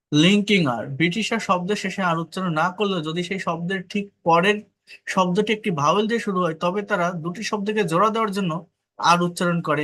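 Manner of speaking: ordinary talk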